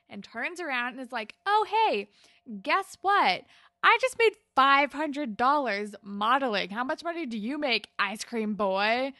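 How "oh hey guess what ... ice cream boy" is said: loud, high mocking call; triumphant taunt. Terry turns on the ice cream boy and yells back, loud gloating taunt. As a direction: shout it back at him, gloating.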